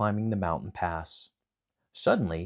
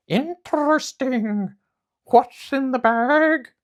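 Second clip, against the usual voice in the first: high, loud bird voice, drawn out slowly; trilling pheasant; 9 dB louder, slower than usual. Acted. Pheasant lands and asks Momotaro, slow high trill.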